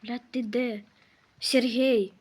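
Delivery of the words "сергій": loud call across the house. Real, not acted. Calling the game baby. (гучно кличе)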